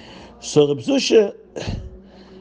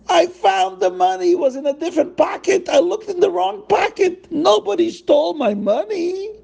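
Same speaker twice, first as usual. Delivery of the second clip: much higher, squealing relief; overjoyed father. Acted. Bride's father tells everyone he found the money, overjoyed. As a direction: burst out with giddy relief.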